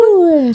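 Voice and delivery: high-pitched cheering "woo"; celebratory whoop. Real, not acted. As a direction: whoop for a friend's win. Cheering co-host's nursing school progress.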